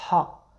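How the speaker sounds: a breathy ḥa from the back of the throat; throaty Arabic ḥa. Shown as a demonstration. Teacher models the throaty Arabic ḥa. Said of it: "ça vient vraiment du fond de la gorge".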